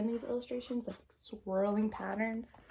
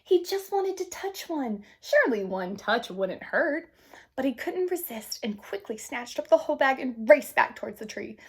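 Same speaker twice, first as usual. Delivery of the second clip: put-on gentle read-aloud to children; storybook narration; 3 semitones higher, 8 dB louder than usual. A narrator's reading. Teacher reading a picture book to the class, gentle narration.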